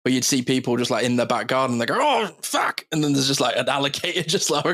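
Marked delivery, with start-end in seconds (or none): 2.0-2.9 startled curse. Retold or imitated. Australian finds crocodile in garden.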